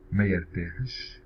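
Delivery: careful slow demo of ما يرتاحش; ما يرتاحش said slowly and distinctly; slow model. Shown as a demonstration. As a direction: draw out ما يرتاحش for the learner. Teacher models example slowly.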